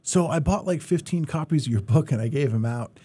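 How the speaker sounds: high, loud, eager report; eager guy. Retold or imitated. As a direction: pitch it up like a keen fan. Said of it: "He's like".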